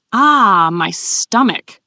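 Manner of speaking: loud drawn-out "ah", then slow words; between laughs. A narrator's reading. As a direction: groan as if worn out by laughing. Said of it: "she said between laughs".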